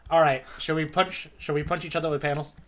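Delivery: ordinary talk, nothing marked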